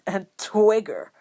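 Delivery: loud, scornful scoff on Twigger; mock him with the word; derisive. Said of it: "with a derisive snort".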